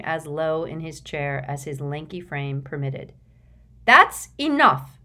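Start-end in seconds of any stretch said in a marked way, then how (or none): 3.9-5.0 loud, slow and firm; forceful. A narrator's reading. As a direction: put your foot down hard. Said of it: "said forcefully".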